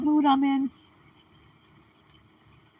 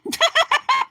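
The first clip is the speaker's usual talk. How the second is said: sudden high burst of laughter; real amused laugh, high and quick; 14 semitones higher than usual. Real, not acted. Laughing at a chat comment.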